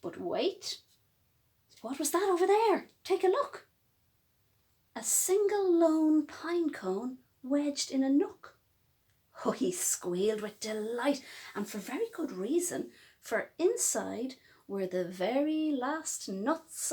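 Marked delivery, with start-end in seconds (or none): none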